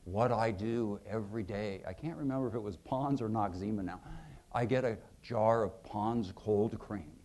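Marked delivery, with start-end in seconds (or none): 0.0-1.8 low, soft, slow senator voice; Dirksen's voice. Retold or imitated. Senator sharing his secret cure, low and slow. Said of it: "He said". 4.5-7.1 a lightly lowered, slow senator voice; slow and a touch low, confiding; Dirksen's voice. Retold or imitated. Senator explaining the cold cream trick, slow and soft. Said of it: "He said".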